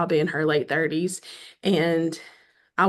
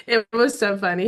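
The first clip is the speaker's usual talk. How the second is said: normal talking voice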